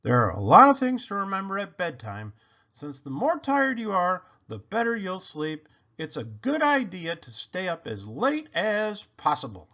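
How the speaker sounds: ordinary delivery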